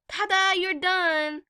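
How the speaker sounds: high, loud, slow sing-song ta-da; sarcastic imitation of a chirpy beautician; mock cheerful waxer. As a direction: ham up the waxer's fake cheer.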